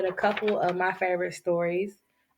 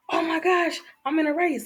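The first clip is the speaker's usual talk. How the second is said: high-pitched sudden alarm; startled hare; 9 semitones higher than usual. Acted. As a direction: wake with a jolt and panic. Hare wakes up mid-race.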